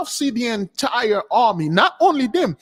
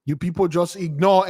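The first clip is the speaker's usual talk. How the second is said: usual speaking voice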